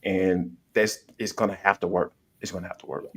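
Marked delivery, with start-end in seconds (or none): 2.4-3.1 much softer repeat; quiet echo. Real, not acted. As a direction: say it again under your breath.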